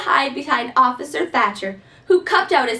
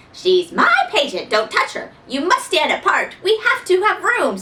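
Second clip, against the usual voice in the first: doctor voice; 7 semitones higher, 3 dB louder than usual. Acted. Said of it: "said Doctor Eisenbart".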